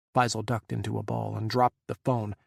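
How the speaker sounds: plain reading voice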